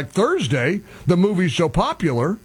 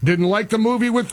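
ordinary delivery

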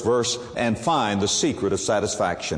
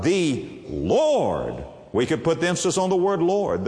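usual speaking voice

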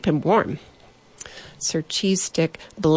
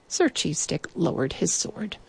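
ordinary delivery

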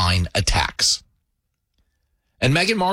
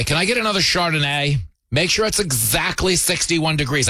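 ordinary talk, nothing marked

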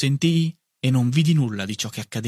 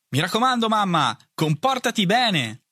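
called out; 8 semitones higher than usual. A narrator's reading. Brother teasing mother from the train steps, called out. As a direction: shout it down from the train. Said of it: "gridò dall'alto".

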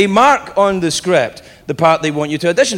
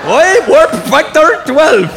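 high, loud, stretched-out engineer's cry; mock impression of an incomprehensible engineer; garbled Scotty; 9 semitones higher, 5 dB louder, slower than usual. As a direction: mangle it slowly in a hammed-up Scottish voice. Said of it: "Scotty in Star Trek was the only one we couldn't understand".

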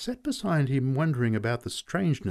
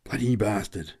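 gruff curse. Acted. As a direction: spit it out under his breath. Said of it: "he kept repeating".

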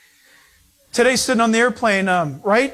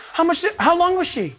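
high-pitched imitation of a curious woman; gushing women; 8 semitones higher than usual. Woman passenger quizzing parents about a newborn, gushing. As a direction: play a baby-smitten passenger, pitched up.

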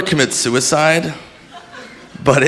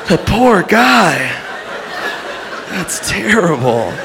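mock pity; 3 semitones higher, slower than usual. Witness feeling sorry for the dead man, mock pity. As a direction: draw it out in hammed-up sympathy.

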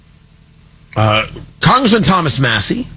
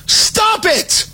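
high, shouted plea to stop; pitched-up frantic shout; exasperated shout; 13 semitones higher than usual. Real, not acted. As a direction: yell it at him in disbelief. Yelling at Tucker in disbelief.

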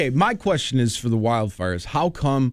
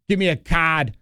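put-on Boston accent; 3 semitones higher than usual. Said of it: "Boston people can't say their R's".

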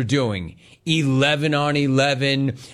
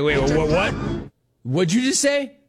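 mock disbelief; 2 semitones higher than usual. Host acting out a coach's reaction, mock disbelief. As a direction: imitate a startled double take.

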